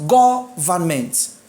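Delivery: "Government" slowly, GO hit hard; stress on GO. Shown as a demonstration. Teacher shows ment changes nothing, "Government" stressed on go. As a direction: stretch "Government" with the weight on first syllable go. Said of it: "Go. So it is what?".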